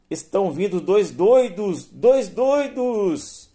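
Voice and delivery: high, loud cry of alarm, last word drawn out; desperate shout. A narrator's reading. Woman yelling at two approaching strangers, desperate high shout. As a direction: shout a frantic warning, stretching the repeat. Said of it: "gritou desesperada".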